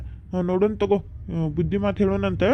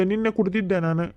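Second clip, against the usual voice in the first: ordinary talk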